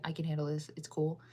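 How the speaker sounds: usual speaking voice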